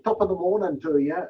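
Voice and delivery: a playful stage-Irish greeting; Irish accent. Retold or imitated. Author greeting an Irish listener in a mock Irish accent. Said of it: "That's my best Irish accent I can put on".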